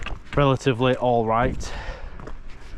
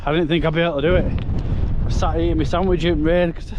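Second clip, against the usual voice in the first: raised voice over roaring wind; shouting over wind; 4 semitones higher than usual. Real, not acted. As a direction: shout to the camera through a gale. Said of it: "the wind is horrendous".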